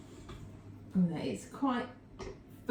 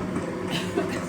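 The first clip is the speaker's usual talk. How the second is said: dry coughing fit from icing sugar; coughing on dust. Real, not acted. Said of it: "Sorry, I'm still choking on the".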